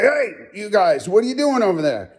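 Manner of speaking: high-pitched mock holler; imitated hollering. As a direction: call out as if from across the dump. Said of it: "who's hollering at us".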